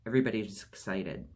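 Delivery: plain reading voice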